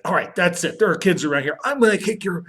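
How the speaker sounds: hammed-up fighting words; mock angry threat. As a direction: play a fed-up dad losing it. Acted threat to costumed man.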